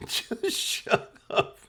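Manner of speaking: begging him to stop while cracking up; whispered shut up. Retold or imitated. Cousin hushing him at funeral.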